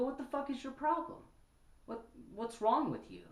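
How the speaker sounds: high, sharp indignant challenge; indignant callout. Pretend. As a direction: confront the bigot head on.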